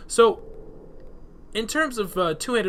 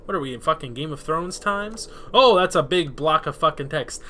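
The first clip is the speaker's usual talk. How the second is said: ordinary talk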